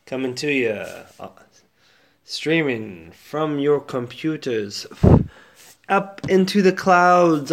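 booming late-night radio host intro; radio announcer. Acted. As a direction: sell the show opening like a DJ. Radio host opening the show.

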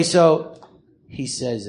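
ordinary talk

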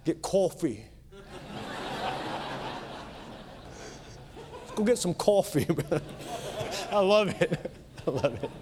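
put-on a Kentucky preacher's poor try at a New York accent; bad New York accent. Preacher mimics New York students ordering coffee, in a weak New York accent. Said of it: "a Kentuckian trying to speak like a New York guy".

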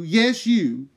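normal talking voice

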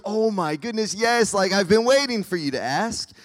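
high, loud, thrilled yes; acted delight. Preacher playing Jesus welcoming a request, gushing excitement. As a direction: gush as if you had been waiting ages.

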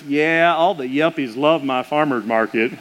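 a hint of a rural Southern brother bragging; country brother voice. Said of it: "my brother's pretty country".

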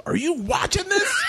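high incredulous shout; imitating Tom. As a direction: Tom can't believe you're missing it. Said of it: "Tom goes".

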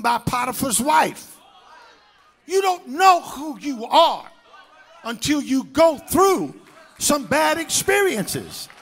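full-force shouted preaching, rising. Acted. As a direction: drive it home to the congregation.

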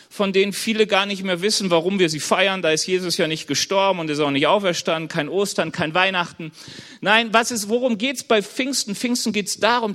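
normal talking voice

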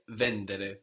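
"vendere" stressed on VEN; stress third from end. Shown as a demonstration. Teacher contrasts the exception for the student, stress on VEN in "vendere". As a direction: land on VEN and keep "-dere" short in "vendere". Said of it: "But here".

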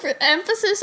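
helpless giggly, hiccupy speech; laughing with hiccups. Real, not acted. Co-host interrupting the rant to tease, laughing with hiccups. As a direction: try to get words out through laughter. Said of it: "Do you have the hiccups?".

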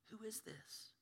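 whispered in wonder; preacher echoing the disciples in a whisper; awed whisper. Pretend. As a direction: breathe it out in awe. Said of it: "whispered awe".

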